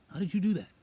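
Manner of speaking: high, loud astonished question; amazed partner. Retold or imitated. Partner amazed that the goat came, surprised. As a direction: sound stunned. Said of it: "my partner was like".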